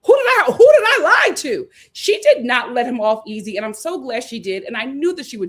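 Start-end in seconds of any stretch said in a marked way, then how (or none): 0.0-1.7 louder, higher indignant protest; mock indignant. Seoul firing back at Sincere, hammed-up indignant. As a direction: push back, offended. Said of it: "And she was just like".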